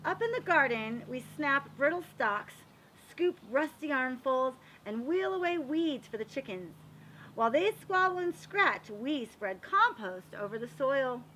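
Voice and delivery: lively, sing-song picture-book reading; reading to children. A narrator's reading. Reading a garden picture book to children, lively storytelling. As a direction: read it like story time for little kids.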